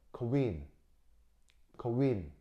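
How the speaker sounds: "queen" as a weak "크" plus "윈"; 분리된 K-W 발음. Shown as a demonstration. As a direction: say "queen" like "크-윈", not "퀸". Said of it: "크 하고 실제로 발음이 되는 건". ("queen"을 "크윈"처럼 발음)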